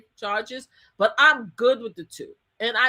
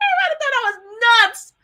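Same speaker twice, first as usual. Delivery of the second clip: ordinary talk, nothing marked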